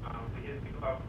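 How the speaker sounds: faint, far from the phone; speaking away from the speakerphone; phone held away. Real, not acted. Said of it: "Still can't hear you".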